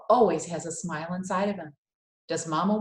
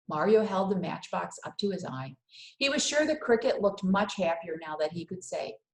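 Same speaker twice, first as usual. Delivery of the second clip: ordinary delivery, nothing marked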